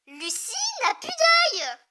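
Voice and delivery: high, loud and slow horrified cry; stunned by the sight, cry it out; horrified slow outcry; alarmed exclamation. Real, not acted. Shocked by injured character.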